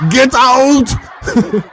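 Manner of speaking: action-hero growl breaking into a laugh; laughing through the movie line; Arnold Schwarzenegger. Retold or imitated. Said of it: "In my best Arnold Schwarzenegger voice".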